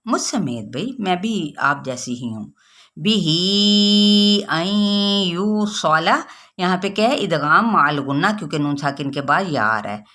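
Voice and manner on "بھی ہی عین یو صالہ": drawn-out tajweed recitation, slow; slow Quranic recitation. Shown as a demonstration. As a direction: recite it slowly, every letter stretched. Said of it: "صوت پر پڑھنا ہے".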